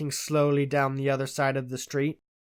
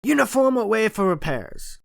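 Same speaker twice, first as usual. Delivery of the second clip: louder, higher, curt soldierly reply; gruff; 7 semitones higher, 5 dB louder than usual. A narrator's reading. Retired sergeant answering the doctor, gruff loud reply. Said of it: "he said gruffly".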